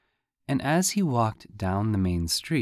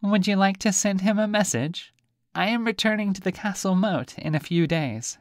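high, squeaky little fish voice; high teasing fish; talking fish; 10 semitones higher than usual. Acted. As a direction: play a cheeky fish, much higher. Fish offers message.

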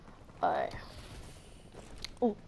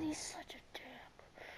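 barely voiced, slow and very soft; whispered insult; 2 semitones higher, 22 dB quieter than usual. Real, not acted. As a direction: hiss the insult under the breath. Grumbling after being killed.

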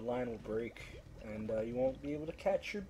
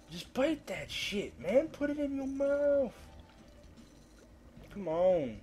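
pleading, rising much higher by the end; mock desperate plea, high-pitched; whiny pleading; 11 semitones higher, slower than usual. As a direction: beg the fish in a whiny high voice. Pleading with a fish.

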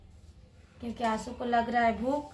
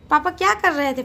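ordinary talk

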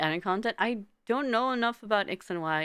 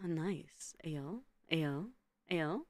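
soft unvoiced whisper; hushed amused whisper to chat; 2 semitones lower, 6 dB quieter, much slower than usual. Real, not acted. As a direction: breathe the words out like a secret aside.

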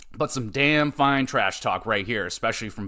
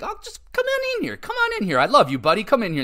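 much higher, syrupy fake-friendly voice; mock Damien welcome; 10 semitones higher, faster than usual. Mimicking Damien luring Truth into the ring, hammed-up sweet high voice. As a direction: ham up a sickly sweet invitation before the beatdown. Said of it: "I like Damien being like".

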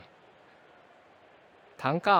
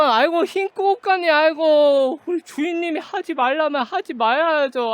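excited; 6 semitones higher, 5 dB louder than usual. Real, not acted. Streamer fawning over donating viewer.

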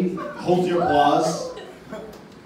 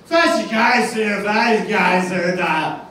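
put-on slurred drunk Boston accent, higher and louder; 3 semitones higher, 3 dB louder, slower than usual. Comic imitating drunk Boston fan, slurred Boston accent. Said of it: "a drunk Boston accent".

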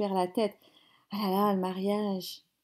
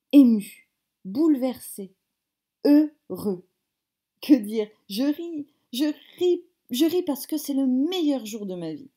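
loud, high gush of joy; overjoyed outburst; 4 semitones higher, 7 dB louder than usual. Acted. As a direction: burst out with happiness. Groom laughs with joy.

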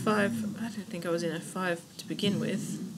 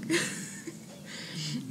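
high, leftover giggles; amused giggle; 9 semitones higher, 3 dB quieter than usual. Real, not acted. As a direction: giggle helplessly at yourself. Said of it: "Fuck, that was funny".

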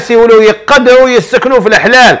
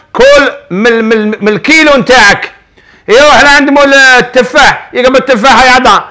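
ordinary talk

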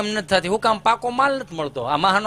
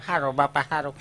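meek grandmother; 7 semitones lower, 4 dB quieter than usual. Retold or imitated. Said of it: "બા બિચારી પૂછે".

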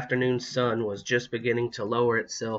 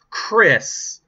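loud, high motherly reproach; cross mother calling out her child; scolding; 9 semitones higher, 8 dB louder than usual. A narrator's reading. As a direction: call the name sharply to scold. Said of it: "Missus Powell scolded".

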